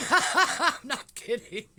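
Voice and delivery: loud, high laughing. Real, not acted. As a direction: laugh through the words. Laughing about rap sheet.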